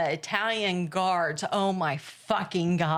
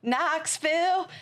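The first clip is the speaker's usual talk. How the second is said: a slow, higher Tennessee drawl; Southern accent; 8 semitones higher, slower than usual. Retold or imitated. Quoting her friend answering the guard, in a Southern drawl. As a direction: draw the town name out, Southern style. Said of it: "she's like".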